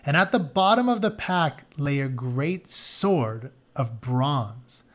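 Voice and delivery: ordinary delivery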